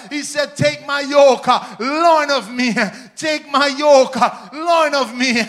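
loud, rising preacher's call; preaching at full force. Acted. Preacher urging his church forward, loud full-force preaching. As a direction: drive it home to the congregation.

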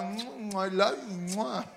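soft mock kissy love talk; mushy lover talk. Preacher mocking a man no one would marry, soft kissy talk. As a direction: coo it like a smitten suitor. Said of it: "a man that talk".

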